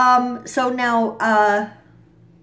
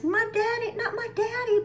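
put-on much higher childish voice, a bit softer; mock little girl; 14 semitones higher, 3 dB quieter than usual. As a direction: mimic a naive little daughter. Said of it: "she's got this ... blinders on".

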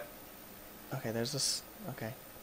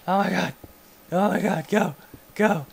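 high, loud, urgent bursts; panicked urging; 7 semitones higher, 5 dB louder than usual. Real, not acted. Gamer fleeing an exploding creature, panicked urging. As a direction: urge it on in a panic.

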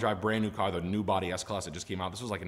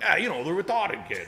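mocking coworker; 8 semitones higher, 5 dB louder than usual.

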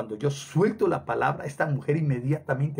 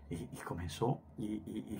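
ordinary talk, nothing marked

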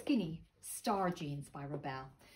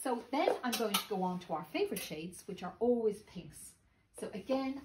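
normal talking voice

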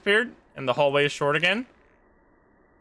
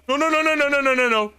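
panicked pleading; 9 semitones higher, 4 dB louder than usual. Real, not acted. Demon girlfriend spotted nearby.

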